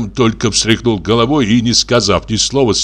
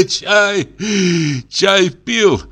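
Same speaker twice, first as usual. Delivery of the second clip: high, choked-with-laughter voice; giggly, high-pitched words broken by laughs; laughing while talking; 10 semitones higher, much slower than usual. A narrator's reading. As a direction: get the words out through a fit of laughter. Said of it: "выговорил он сквозь смех".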